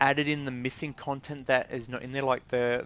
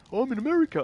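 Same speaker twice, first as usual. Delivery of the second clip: higher mocking voice; mocking American; 9 semitones higher, 4 dB louder, much faster than usual. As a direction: play the clueless American, pitched up. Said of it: "I said".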